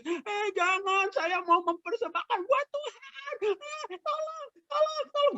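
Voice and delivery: high-pitched tearful pleading; mock crying. Israelite begging thieves to leave the offering, mock crying. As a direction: wail and beg in a put-on high cry. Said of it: "lalu orang Israelnya nangis".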